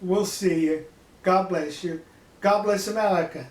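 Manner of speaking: ordinary talk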